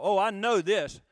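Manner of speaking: loud mock know-it-all; cocksure. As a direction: blurt it out like someone sure they know. Voices an overconfident know-it-all.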